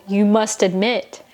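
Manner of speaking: ordinary delivery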